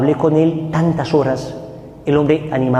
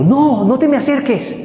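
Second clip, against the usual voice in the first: shouted refusal; 11 semitones higher than usual. Retold or imitated. Addict yelling at the preacher to stay away. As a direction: push him away with a yell. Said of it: "el grito que me dio".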